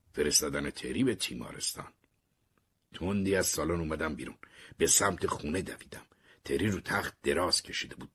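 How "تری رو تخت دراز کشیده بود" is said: ordinary delivery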